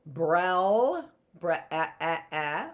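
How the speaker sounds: "eh" as a short e vowel repeated; short vowel sounding. Shown as a demonstration. Teacher sounding out the short vowel in brel. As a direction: stretch "bre" then hit "eh" short. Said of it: "another short vowel".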